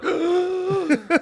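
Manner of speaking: high laughing gasp of pain. Retold or imitated. Retelling the rib injury, a high laughing gasp. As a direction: gasp like the air was knocked out.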